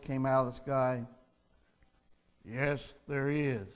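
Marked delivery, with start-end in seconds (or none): 2.5-3.7 low, slow, softer voice of God; God answers the dangling tourist, calm and low; calm voice from heaven. Acted. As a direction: speak down from the sky, unhurried and calm. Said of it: "this calm, powerful voice".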